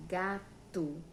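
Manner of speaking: "gato" split slowly as ga, to; teacher's syllable-by-syllable "gato". Shown as a demonstration. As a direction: say "gato" in two separate beats, ga and to. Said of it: "ela é composta por duas sílabas".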